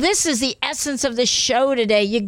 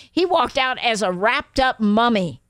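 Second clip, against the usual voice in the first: ordinary talk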